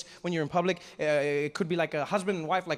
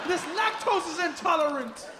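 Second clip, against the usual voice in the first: high, slow appalled cry; mock horror; 10 semitones higher than usual. Shopper horrified in the dairy aisle, high mock alarm. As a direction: exclaim it in drawn-out, high-pitched alarm.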